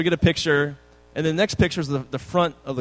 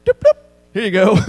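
much louder, higher careless sing-song; breezy sing-song; 6 semitones higher, 10 dB louder, faster than usual. Retold or imitated. Returning the muddy Ferrari to its owner, sing-song. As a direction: toss the keys back carelessly. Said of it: "I was like".

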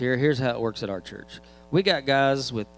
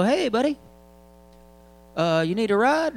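high, bright friendly call, mock helper; cheery parking attendant; 10 semitones higher, 4 dB louder than usual. Parking lot volunteer calls to a distant churchgoer, cheerful and high. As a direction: play the eager golf-cart guy offering a ride. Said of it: "they'll go".

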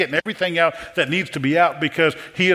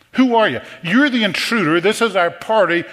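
louder, raised, affronted demand; indignant challenge; 6 semitones higher, 4 dB louder than usual. Acted. Temple leaders confronting Jesus, indignant and louder. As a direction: bristle at the intruder.

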